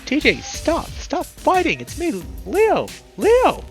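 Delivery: very high, loud, straining yell; desperate shouting. A narrator's reading. Leo pinning the thrashing lynx, desperate shouting. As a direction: shout right into his ear. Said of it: "shouting into his ear".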